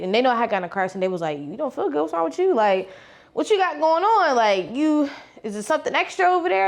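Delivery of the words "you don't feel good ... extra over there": high, over-the-top nosy coworker voice. Retold or imitated. Coworkers making a big fuss over her, in a high over-the-top voice. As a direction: play it up like prying old folks. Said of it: "how old folks, they just take it, like, oh, over the top".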